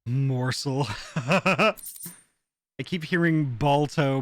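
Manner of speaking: ordinary talk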